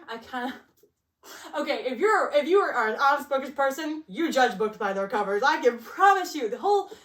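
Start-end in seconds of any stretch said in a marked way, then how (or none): none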